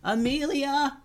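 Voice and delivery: high-pitched calling out, held back in volume; mock distant yell. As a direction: call out for a lost friend, high and restrained. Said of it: "Tubes yelled".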